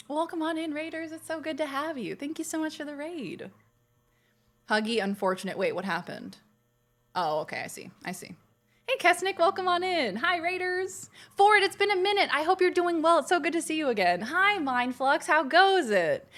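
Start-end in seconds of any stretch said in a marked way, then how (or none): 0.1-3.5 soft, high-pitched thanks; sweet welcome. Real, not acted. Streamer thanking raiders for coming, soft, high and sweet. 8.9-16.3 high, eager hellos; friendly excited greeting. Real, not acted. Streamer greeting Kesnick and returning raiders, high and excited.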